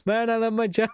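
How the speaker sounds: lightly hammed delighted agent; happy cop. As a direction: savour the job. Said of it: "I love the doorama".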